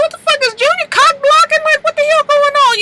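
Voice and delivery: high, loud, incredulous squeal; watching the show, outraged the friend keeps cock blocking; outraged disbelief. Real, not acted. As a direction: shriek at the screen in disbelief. Own outraged reaction while watching.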